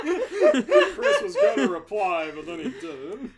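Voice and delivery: laughing. Real, not acted. Friend laughing joke.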